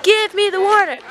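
very high, loud, exasperated demand; retold scream. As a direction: act out screaming in someone's face. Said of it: "I'm screaming in his face".

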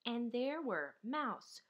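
usual speaking voice

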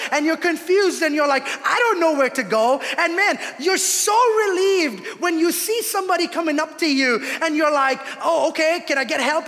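loud, high, driving sermon cry; full-force preaching. Acted. Pastor urging the congregation, loud and high preaching. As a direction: preach it out with rising fervour.

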